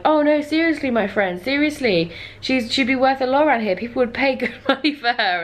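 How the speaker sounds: mock-sincere sales pitch; earnest insistence. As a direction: press the point eagerly, as a retold quote. Acting barterer insisting to Ryan.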